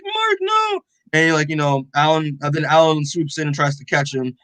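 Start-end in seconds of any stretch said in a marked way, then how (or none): 0.0-0.8 acted-out cry of regret, pitched way up; regretful Oliver. Oliver calling to Mark as he regrets it, high mock cry. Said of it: "Oliver starts regretting his decision".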